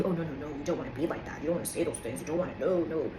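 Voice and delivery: a lightly voiced hushing naysayer; hushed, low tut-tutting of society; mock voice of society. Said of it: "that society tells you".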